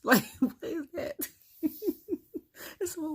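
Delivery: high, loud burst of real laughter; laughing hard. Real, not acted. Laughing at Pat's wholesale line, breaking into real high laughter. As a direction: crack up while asking it.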